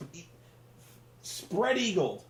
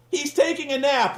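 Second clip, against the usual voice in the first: ordinary talk, nothing marked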